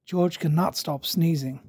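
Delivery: ordinary delivery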